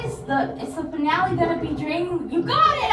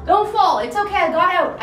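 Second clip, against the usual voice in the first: usual speaking voice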